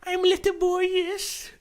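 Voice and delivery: put-on very high squeaky kid voice; mock little boy. Friend jokingly playing an eleven-year-old boy, squeaky high. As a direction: pipe it up like a small boy.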